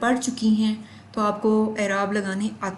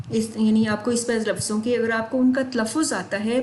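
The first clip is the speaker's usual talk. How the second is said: ordinary talk, nothing marked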